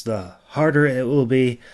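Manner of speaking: ordinary delivery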